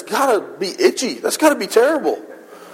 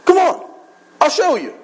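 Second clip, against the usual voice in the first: eager invitation; 8 semitones higher than usual. Retold or imitated. Jesus inviting the disciples.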